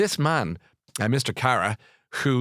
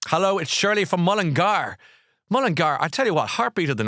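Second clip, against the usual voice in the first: a lightly voiced cheery caller, higher; mock chirpy caller; 8 semitones higher, 4 dB louder than usual. Reading a listener's message, lightly voiced as a chirpy caller. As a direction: chirp her hello like a cheerful fan. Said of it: "Shirley says".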